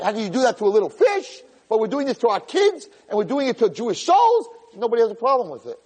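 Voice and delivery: high, impassioned accusation; indignant rebuke. Real, not acted. Rabbi accusing listeners of ignoring kids, high indignation.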